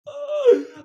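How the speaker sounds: high, loud laugh; sudden high laugh; burst of laughter. Real, not acted. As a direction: crack up at the joke. Laughing at the chat.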